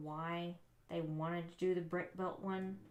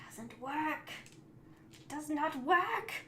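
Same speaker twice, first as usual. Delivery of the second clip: fed up; 8 semitones higher, 3 dB louder than usual. Real, not acted. Parts won't connect, fed up.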